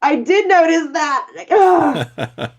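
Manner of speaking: high, loud, drawn-out excited squeal; thrilled. Real, not acted. Guest learns her scream made the trailer, thrilled. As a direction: gush at top pitch with real delight.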